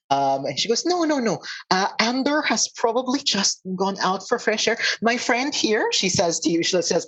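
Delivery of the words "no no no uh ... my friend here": high, insistent reassurance; acted nervous cover-up, pitched high; hasty reassurance. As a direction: wave away the rumour in a lifted voice. Centaur hastily dispels town's rumour.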